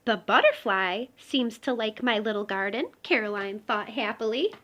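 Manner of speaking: gentle storybook read-aloud; reading to children. Acted. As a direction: tell it like a bedtime picture book. Reading picture book aloud.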